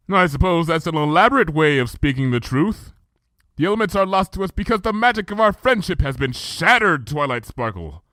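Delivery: measured, archaic royal speech; Luna's regal voice. Acted. Luna recounting the past to Twilight, stately.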